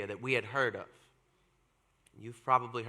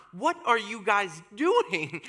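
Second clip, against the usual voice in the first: loud, high, drawn-out protest; indignant yell, slow and raised; retold mortified yell; 6 semitones higher, 5 dB louder, slower than usual. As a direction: stretch it out in loud, appalled disbelief. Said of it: "I yelled".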